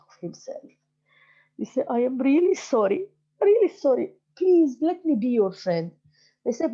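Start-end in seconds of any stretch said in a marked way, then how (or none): 1.9-5.9 pleading apology. Acted. Donkey apologizes to friends.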